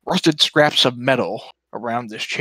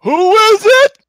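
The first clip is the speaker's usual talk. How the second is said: much higher, louder door guard bellow; dopey cartoon bellow; 11 dB louder, slower than usual. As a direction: yell it like an impatient brute. Doorman voice behind door.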